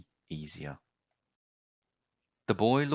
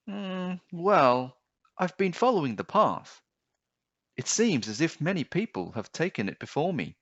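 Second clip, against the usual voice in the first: put-on higher, earnest little boy voice; boy voice, pitched high; young boy; 6 semitones higher than usual. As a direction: explain it like a trusting child.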